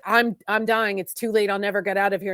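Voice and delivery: normal talking voice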